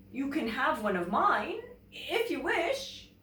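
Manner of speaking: offering kindly, rising high at the end; generous polar bear. Acted. Polar bear offering Bear a fish, lightly voiced. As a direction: hand over a fish. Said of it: "he said".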